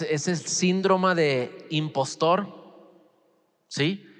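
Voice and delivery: ordinary delivery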